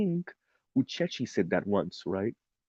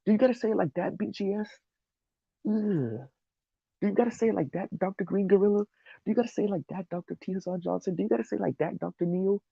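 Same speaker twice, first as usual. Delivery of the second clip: high-pitched imitation of squeamish critics; mock cringing; 10 semitones higher than usual. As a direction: plead in a high, put-on voice as if cringing. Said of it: "people are cringing".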